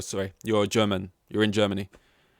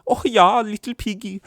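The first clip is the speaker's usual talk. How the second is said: high-pitched, hammed-up German accent; mock German accent; 11 semitones higher, 7 dB louder than usual. Comedian trying out what German sounds like, mock German accent. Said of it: "German's like".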